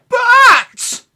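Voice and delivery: very high, loud shriek; piercing shout of alarm; panicked cry. Acted. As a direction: scream it in storybook terror. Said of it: "they cried".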